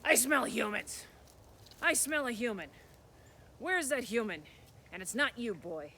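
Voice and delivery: the troll hunting for the hidden human; troll sniffing. Acted.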